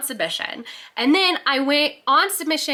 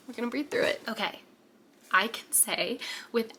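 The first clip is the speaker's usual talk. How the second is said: holding back tears; 6 dB quieter than usual. Real, not acted. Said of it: "I'm getting emotional".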